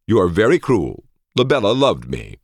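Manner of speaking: high, hurt indignation; affronted and high-pitched; wounded protest. A narrator's reading.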